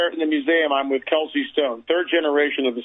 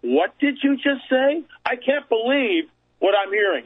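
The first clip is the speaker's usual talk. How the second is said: incredulous; 7 semitones higher than usual. Retold or imitated. Interviewer reacting to the curator's translation of the stone, incredulous. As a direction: re-enact your own stunned disbelief. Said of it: "I'm incredulous at this point".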